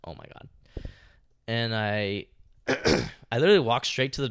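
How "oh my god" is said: hushed frightened gasp of words; whispered. Retold or imitated. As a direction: breathe it out in fear. Retold fearful reaction, whispered.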